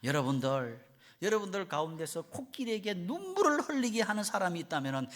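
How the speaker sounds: acted showman's announcement, pitched high; trainer's challenge. Elephant trainer challenges the audience, showman's proclamation. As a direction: call out a challenge to the crowd. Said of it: "조련사가 이런 제안을 합니다".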